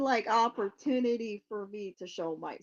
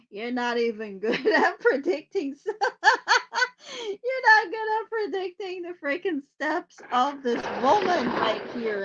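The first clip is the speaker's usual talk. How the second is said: louder mock scolding, cracking up; laughing through a mock scolding; 6 semitones higher, 8 dB louder than usual. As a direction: scold while barely holding in laughter. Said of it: "Someone's like".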